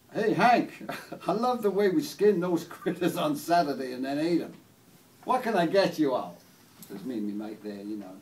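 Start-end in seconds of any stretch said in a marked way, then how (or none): 0.1-6.4 loud Oklahoma diner waitress. Acted. Waitress greeting Hank and customers in Oklahoma, loud, folksy role. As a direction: call out the order as a cowboy-country waitress. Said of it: "And the waitress come up".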